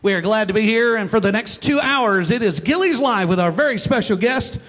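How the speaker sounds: loud, punchy show-opener announcing; radio show host opening. Acted. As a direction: welcome the crowd with big emcee energy. Host opens live show.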